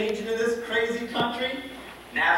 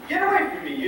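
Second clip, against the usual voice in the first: high-pitched, startled get away; flustered outcry; 11 semitones higher, faster than usual. Acted. Cowboy shooing off his sweetheart, high startled outcry. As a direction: yelp it out in mock alarm.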